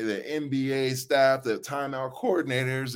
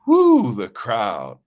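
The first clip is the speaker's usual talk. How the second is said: excited woo; 10 dB louder, much slower than usual. Real, not acted. Reliving the roaring crowd.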